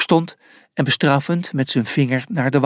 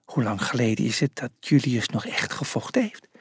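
soft, anxious whisper; hushed and uneasy; nervous whisper; 2 semitones lower, 7 dB quieter than usual. A narrator's reading. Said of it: "fluisterde Rufus nerveus".

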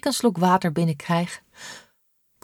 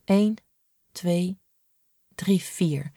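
whispered counting; 4 dB quieter, much slower than usual. Acted. Counting her brushes under breath.

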